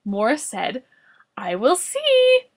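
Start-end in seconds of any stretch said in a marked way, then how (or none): 1.4-2.5 high, slow goofy voice; silly high voice. Acted. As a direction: sound dim and innocent. Silly character line.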